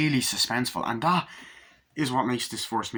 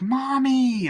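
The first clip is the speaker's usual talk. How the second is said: put-on high, loud child cry of Mommy; child calling; 7 dB louder than usual. Little boy calling to his mother, high and loud. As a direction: call out like a small boy. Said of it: "Andy's like".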